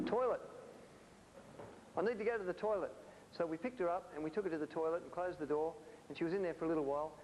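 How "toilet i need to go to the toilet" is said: calling out. Retold or imitated. Woman in the steam bath calls for the toilet, mock urgent shout. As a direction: retell her urgent shout, half-acted. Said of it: "she called out".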